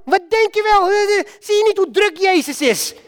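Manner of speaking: high, scolding crowd voice; irritated bystanders snapping at a beggar; crowd telling him off. Acted. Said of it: "die daar riepen van".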